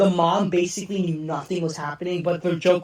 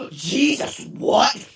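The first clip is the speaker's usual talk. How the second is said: usual speaking voice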